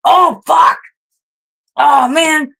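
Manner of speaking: sudden high, loud curse at an accident; startled curse. Real, not acted. Streamer knocking something off her desk mid-stream, sudden loud curse. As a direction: blurt it out as something falls.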